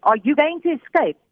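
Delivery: high, pressing question; retold interrogation. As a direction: imitate a soldier demanding an answer, mildly. Soldiers repeat the interrogation.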